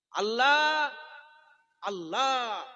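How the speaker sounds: high loud drawn-out Allah; ringing devotional cry; exalted cry. Real, not acted. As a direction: call out the name in awe. Preacher exalting God's name.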